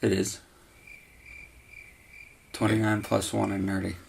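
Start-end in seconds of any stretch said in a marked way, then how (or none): none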